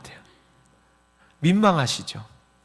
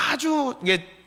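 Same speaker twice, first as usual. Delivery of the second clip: normal talking voice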